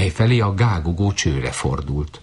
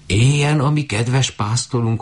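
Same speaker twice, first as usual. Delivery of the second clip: high goose cheer; lightly voiced high goose; 7 semitones higher than usual. A narrator's reading. Geese praise shepherd.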